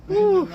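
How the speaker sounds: high, loud blown-out exhale; loud exhausted whew. Real, not acted. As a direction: puff it out like you just made it. Worn out after the snow.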